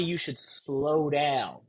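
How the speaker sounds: slow right down to show it; slowed delivery. Shown as a demonstration. Coach demonstrating slowing down, slow deliberate. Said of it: "In reality".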